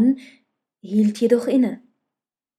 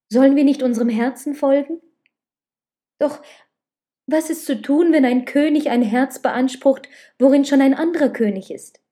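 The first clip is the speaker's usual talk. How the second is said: higher, louder, urgent pleading questions; impassioned questioning; 4 semitones higher, 6 dB louder than usual. A narrator's reading. Woman asks her lover about their divided hearts, impassioned. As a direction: press the questions with rising passion. Lover agonises over two kings.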